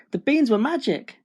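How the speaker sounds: put-on high, amazed young Jack; storybook boy's delighted discovery; excited cry. A narrator's reading. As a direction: cry out in wonder. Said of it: "Jack cried".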